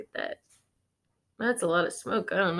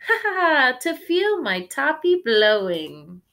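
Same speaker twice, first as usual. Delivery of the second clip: higher, louder spoken ha ha ha; laughing; 5 semitones higher, 8 dB louder than usual. Acted. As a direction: laugh with wind in your hair. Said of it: "Miss Spider laughed".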